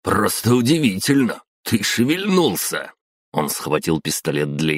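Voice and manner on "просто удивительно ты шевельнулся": amazed. A narrator's reading. Old man amazed patient moved.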